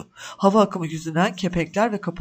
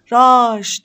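much louder desperate scream; screaming his name; 4 semitones higher, 10 dB louder than usual. A narrator's reading. Mother screaming as her son disappears, desperate cry. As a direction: cry out as he vanishes under the coins. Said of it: "diye haykırdı".

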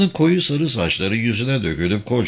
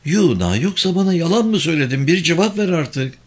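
higher, quick anxious questioning; fearful; 6 semitones higher than usual. A narrator's reading. Woman presses driver on whether she was lied to, fearful. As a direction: demand an answer with understated fear. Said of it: "korku dolu bir sesle devam etti".

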